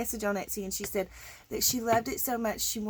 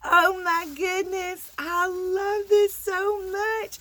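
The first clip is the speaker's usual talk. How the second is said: high, loud, tearful delight; overjoyed; 6 semitones higher, 5 dB louder, slower than usual. Real, not acted. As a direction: gush it, stretched out with emotion. Overjoyed at surprise gift.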